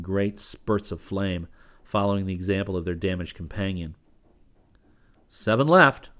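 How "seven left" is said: raised tally call. A narrator's reading. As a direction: call the count out loud. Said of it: "Rip called".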